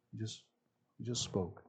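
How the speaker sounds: very soft, hushed, almost under the breath; a soft, quiet demonstration of gentleness; gently spoken. Shown as a demonstration. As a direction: show how gently he spoke. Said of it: "that's not the way Jesus was".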